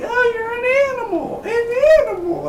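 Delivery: very high falsetto, hammed-up seductive woman; flirty woman.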